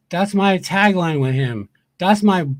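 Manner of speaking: ordinary delivery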